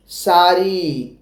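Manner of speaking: slow drawn-out sari; teacher modelling sari slowly; model word. Shown as a demonstration. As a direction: say sari slowly for the class to repeat. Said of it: "बोलिए साड़ी".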